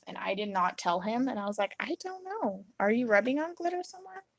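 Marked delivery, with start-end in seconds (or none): 1.8-4.2 high-pitched, innocent playing dumb; feigned innocence. Wife playing dumb to glittered husband, feigned innocence. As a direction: play dumb in a higher voice.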